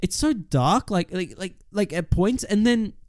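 ordinary talk, nothing marked

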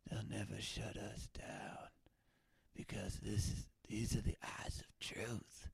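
hushed, very soft whisper; conspiratorial whisper. Acted. Underground webcaster vows they'll never shut us down, conspiratorial whisper. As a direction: whisper it like a secret vow. Conspiratorial vow after rant.

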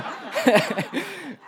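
bright high burst of laughter; real laugh. Real, not acted. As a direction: laugh at yourself, high and light. Laughing at own smallness.

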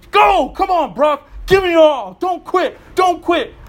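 loud, high coach yelling encouragement. Retold or imitated. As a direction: bellow it like a coach driving a player on. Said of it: "and the coach".

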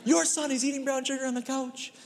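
put-on pointing the finger at the kid's mother; exasperated dad blaming. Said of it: "you instantly just point at".

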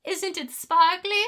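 high, slow, dreamy silliness; mock airhead women. Mocking women written as idiots, high slow airhead voice. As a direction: play a vacant airhead, drawing it out high. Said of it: "Just idiots who have never read a newspaper".

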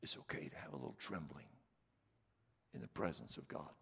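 drops to a near whisper; hushed reassurance. Real, not acted. Preacher hushes to reassure the flock, near whisper. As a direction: gently soothe the trembling listener.

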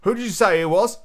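perky, loosely Australian sailor; Australian accent. Acted. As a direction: ask it in a lifted Australian voice. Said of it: "I'll just make him Australian".

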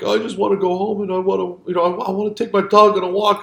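hammed-up weepy plea to go home; crying soldier. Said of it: "he's crying".

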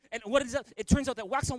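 ordinary talk

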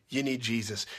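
ordinary delivery

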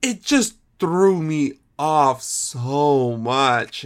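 usual speaking voice

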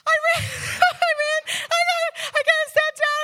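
high, loud, laughing rush; breathless joy, laughing through it; huffing and happy. Retold or imitated. Preacher reliving sitting down on the plane, high, laughing and breathless. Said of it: "huffing and puffing, smiling and so happy".

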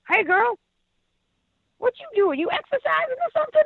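high sassy local woman. Retold or imitated. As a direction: call out like a brassy stranger. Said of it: "most interesting woman, like, character".

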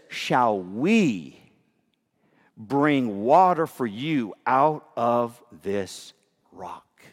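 slow, low, each word weighed; slow emphatic reading. Shown as a demonstration. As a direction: draw it out so the words sink in. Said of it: "Notice what he says here".

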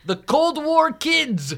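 high, slow mock Dutch accent; mock Dutch radio. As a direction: imitate a Dutch announcer, slowly. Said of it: "it'd be like".

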